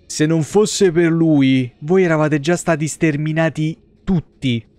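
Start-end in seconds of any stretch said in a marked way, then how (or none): none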